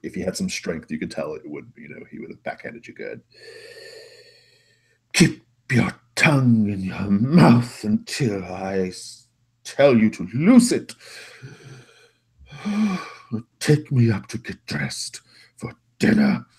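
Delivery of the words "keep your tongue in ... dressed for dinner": loud, slow, imperious order; haughty master, scolding. Acted. Master ordering his goblin about, haughty and cross. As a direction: scold a servant, stretching the words, then sigh.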